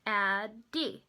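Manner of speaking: "Addie" broken into two separate beats; teacher splitting "Addie" syllable by syllable; sounded out by syllable. Shown as a demonstration. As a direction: sound out "Addie" as Ad, then dee. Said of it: "sound out where the syllables are".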